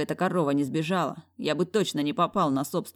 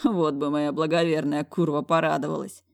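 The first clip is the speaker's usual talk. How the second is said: ordinary delivery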